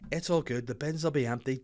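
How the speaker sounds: ordinary delivery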